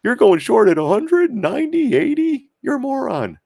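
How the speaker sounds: mocking critics. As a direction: ham up a scoffing critic in a high voice.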